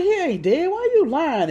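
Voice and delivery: loud, high-pitched mimicked protest; indignant accusation. Brother calling out the lie, indignant.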